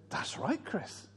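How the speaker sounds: put-on Irish accent. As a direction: quietly confirm it in an Irish accent. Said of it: "He said it with an Irish accent".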